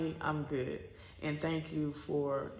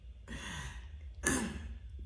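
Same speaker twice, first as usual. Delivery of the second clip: soft laugh; 8 semitones lower, 8 dB quieter than usual. Real, not acted. Laughs at the memory.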